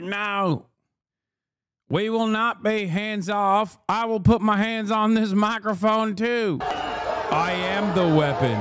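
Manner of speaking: high, slow defiant mock-heroic voice; mock righteous protester, high and slow; mocking the maga lady. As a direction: ham up her proud declaration. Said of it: "She's like".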